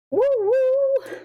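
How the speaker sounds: high, bright woo; excited cheer. Real, not acted. Unwrapping a hazelnut chocolate bar, high excited cheer.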